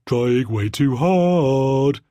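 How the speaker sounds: put-on slow teasing sing-song child; drawn-out mocking sing-song. A narrator's reading. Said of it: "in a sing-song voice".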